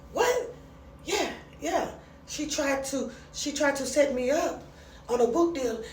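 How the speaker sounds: high-pitched, imitated African-accent retelling; hammed-up attempt at an African accent. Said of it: "I can't do the African voice".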